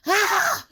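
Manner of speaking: loud frustrated scream; high cry of despair; Tsukasa. A narrator's reading. Tsukasa at wit's end without phone, screaming.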